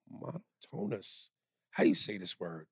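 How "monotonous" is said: monotonous said as "mono-TONE-us"; soft, halting monotonous, pronounced "mon-TONE-us"; mispronounced monotonous. Shown as a demonstration. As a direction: say monotonous slowly with "tone" in the middle. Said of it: "for mispronouncing the word".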